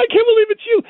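high-pitched, hammed-up tearful gush; mock crying. Starstruck fan meeting Shaq, mock crying. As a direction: imitate an overwhelmed fan in tears. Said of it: "The guy starts crying".

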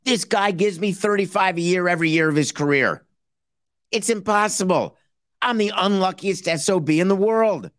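mock lament.

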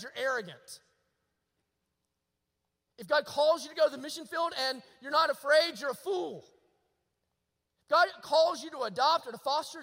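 fervent, hard-hitting preacher; forceful preaching. Real, not acted. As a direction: challenge the congregation with conviction.

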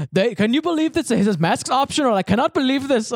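put-on high, incredulous Indian-accented voice; imitated Indian accent. Job seeker amazed at optional masks, imitated Indian accent. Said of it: "I'll do the voice".

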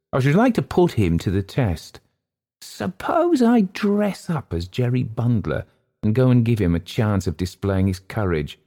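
lightly voiced schemer hatching a prank; scheming Hurst. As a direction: float the dare in a lifted voice.

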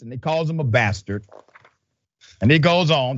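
ordinary delivery

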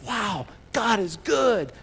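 slow, awed wow, drawn out; amazed wow. Retold or imitated. Crowd watching the jars fill, slow and awed. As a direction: marvel at it slowly. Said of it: "you'd be saying".

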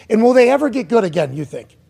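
normal talking voice

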